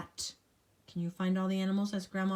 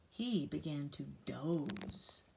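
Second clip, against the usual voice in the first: sleepy; 5 semitones lower, 8 dB quieter, slower than usual. A narrator's reading. Sleepy bedtime reading.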